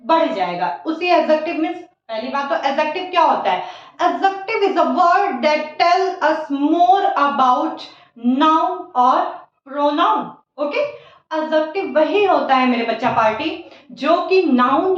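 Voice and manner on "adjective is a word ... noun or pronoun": slow dictation. Shown as a demonstration. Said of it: "teacher dictates definition slowly".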